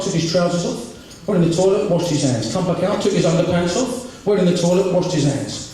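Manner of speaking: ordinary delivery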